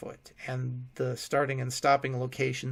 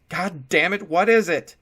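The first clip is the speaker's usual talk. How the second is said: loud higher angry yell; frustrated shout; 5 semitones higher, 11 dB louder than usual. A narrator's reading. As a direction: curse and demand it. Will swears in frustration.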